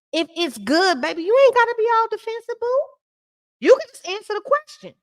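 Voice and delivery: way up high, quick and sassy on the defensive bit; high, mocking "baby, boo" scolding. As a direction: talk down to her, sing-song high and pushy. Scolding Kyle directly, sassy.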